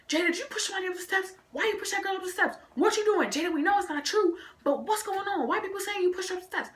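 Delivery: high, rapid mimicked questioning; soft high-pitched chorus of questioners; nosy classmates. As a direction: imitate pestering kids, pitched well up. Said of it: "people keep asking me".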